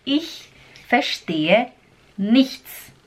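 slow, split in two, nichts lifted; devagar, sílaba por sílaba. Shown as a demonstration. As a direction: pause before nichts, then rise. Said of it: "Fala comigo". (devagar com pausa antes de nichts)

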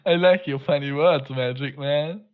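slow meme line in English; meme quote voice. Retold or imitated. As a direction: deliver the meme quote slowly. (Meme-Zitat, langsam)

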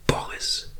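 Boris nur geflüstert, tonlos; flüstere Boris für dich. Acted. Name leise für sich wiederholt. (Boris leise, nachdenklich geflüstert)

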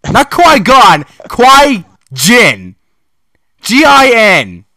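high, loud, stretched-out disbelief; exasperated outburst. Real, not acted. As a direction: rant at the typo in disbelief.

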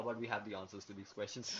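laughing. Acted. Punchline said low with laugh.

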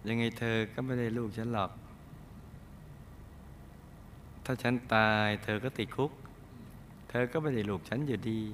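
soft, sweetly mocking dare; too sweet defiance. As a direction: taunt him softly like a lover. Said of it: "หวานไปนะ". (ท้าทายแบบหวานๆ เสียงเบา)

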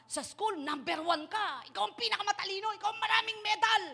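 put-on pushy parent drilling the child to be first. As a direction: build it up like a proud, pushy parent.

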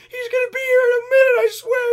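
shrill panicked shout, very high and loud; freaking out. Retold or imitated. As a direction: imitate a girl freaking out at the gate. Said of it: "she's, like, freaking out".

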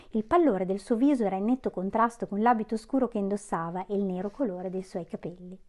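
ordinary delivery, nothing marked

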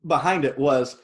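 ordinary talk

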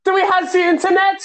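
calling out high. Acted. Hansel shouts to grandpa.

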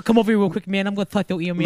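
Mike Tyson voice. Pretend. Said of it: "as Mike Tyson".

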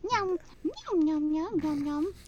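high squeaky cartoon critter; anime cartoon voice. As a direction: chirp it like a cute anime animal. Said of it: "That's the anime version".

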